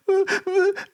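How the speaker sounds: very high mock wail; imitated child's crying. Hurt son answers mum, mock crying. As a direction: fake a kid's high-pitched sobbing. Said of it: "I'm crying".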